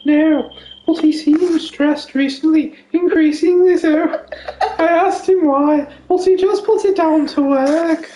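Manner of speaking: posh voice. Pretend. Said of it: "do this voice for ages".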